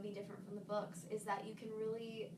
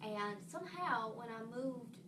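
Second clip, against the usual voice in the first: put-on easy Southern American accent, telling a childhood story. Shown as a demonstration. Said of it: "Okay, Southern".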